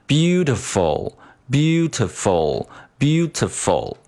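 beautiful very slow, stepping down in pitch syllable by syllable. Shown as a demonstration. Teacher models the pitch pattern, high then stepping down. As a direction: start BEAU high, drop ti, drop ful further. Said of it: "先高再低，然后再更低的这么一个形式".

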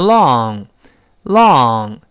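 "long" drawn out slowly with an open ah-like vowel; slow teacher's model of "long". Shown as a demonstration. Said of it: "注意这个声音啊".

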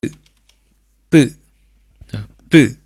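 b as an isolated tense Korean double-p sound; tense consonant. Shown as a demonstration. Teacher showing how the third tense consonant sounds, b said firmly. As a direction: say b separately and firmly, with a falling pitch. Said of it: "发音呢是发".

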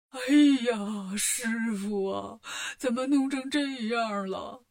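slow, drawn-out mock wail; crying. A narrator's reading. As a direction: wail slowly over your master. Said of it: "八戒一见就哭了".

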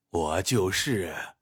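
low, soft, unhurried reply; understated, indifferent. Acted. Old healer admitting who he is to his patient, mildly. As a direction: say it slowly, making nothing of it. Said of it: "淡淡的说".

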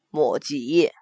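very low male voice; a low, curt self-introduction; terse prince. A narrator's reading. Prince gives his name to a guest, low and terse.

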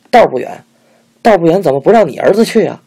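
loud, fast furious challenge; angrier retort. A narrator's reading. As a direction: throw her words back in anger. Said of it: "焦德海火气更大了".

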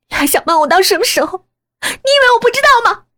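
high, fast, loud accusation; angry confrontation. Acted. Daughter accuses mother of hiding the truth, high and furious. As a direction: fire off the questions in a rising fury.

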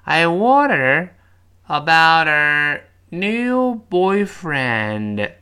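very slow, loud, stretched phrase by phrase; slow breakdown. Shown as a demonstration. Teacher breaking the sentence down, very slowly. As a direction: draw every word out, pausing after WONDER. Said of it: "我们先慢慢读".

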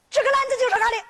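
high-pitched stubborn claim; insistent youngster. Acted. Child argues over basket in story. (孩子高声争辩)

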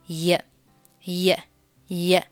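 isolated "也" glide, like a short y; 半元音. Shown as a demonstration. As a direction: demonstrate the linking y sound "也". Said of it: "它读作". (读出也的半元音)

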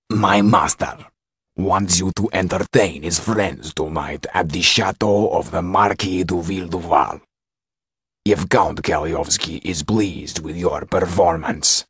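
slow, weighty servant of a count; imposing steward. A narrator's reading. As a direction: play the formidable steward, measured and firm. Steward delivers the count's offer.

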